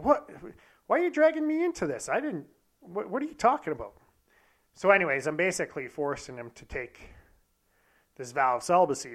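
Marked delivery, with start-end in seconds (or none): 0.0-3.9 high-pitched, baffled objection; mock bewildered friend; incredulous protest. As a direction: protest in disbelief, voice jumping up high. Friend protests being dragged in.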